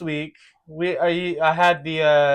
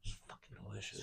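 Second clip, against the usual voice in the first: quick, hushed whisper; savouring whisper; 8 semitones lower, 28 dB quieter, much faster than usual. Pretend. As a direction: whisper it under your breath, savouring it. Savouring a sushi place.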